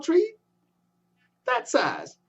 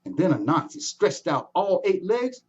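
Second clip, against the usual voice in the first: ordinary delivery, nothing marked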